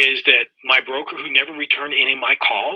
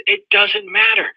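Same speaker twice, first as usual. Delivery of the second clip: stern mentor; 8 semitones higher, slower than usual. Retold or imitated. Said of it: "He says".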